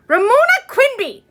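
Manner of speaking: high, loud outraged shriek. Acted. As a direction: shriek her full name in protest. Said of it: "shrieked Susan".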